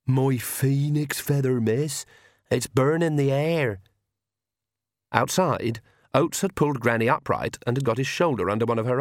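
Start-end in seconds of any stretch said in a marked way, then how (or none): 0.1-3.8 slow, strange servant voice; slow dim-witted servant; odd servant voice. Acted. Hodges Arg servant voice.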